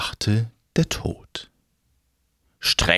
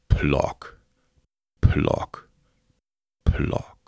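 knocking sound; 7 semitones lower, much slower than usual. Retold or imitated. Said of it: "ein ganz besonderes Geräusch vorstellen".

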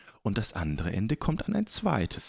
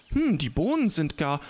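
excited; 8 semitones higher, 5 dB louder than usual. Acted. Spider notices third tug.

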